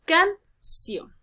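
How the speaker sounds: "canción" split slowly in two, can-ción; syllable by syllable. Shown as a demonstration. Teacher giving an example to the class, syllable by syllable. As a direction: say "canción" in separate syllables, ending on stressed ción. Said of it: "Por ejemplo".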